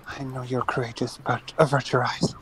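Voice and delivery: softly spoken, urgent care; hushed protective aside. Acted. Holly shielding Anarchy from the gods, hushed.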